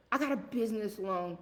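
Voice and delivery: ordinary delivery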